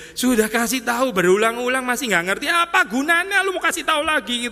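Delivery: voicing Jesus, exasperated with the crowd. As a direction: play Jesus at the end of his patience. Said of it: "kesesekannya Yesus gitu ya".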